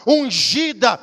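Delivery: loud, high shouted sermon; fiery preaching. Acted. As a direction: proclaim it with full fervour. Preaching at full force. (pregação fervorosa)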